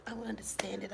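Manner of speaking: real whisper so the neighbor won't hear; hushed whisper behind the door. Real, not acted. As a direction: whisper it, keeping hidden. Neighbor outside her door.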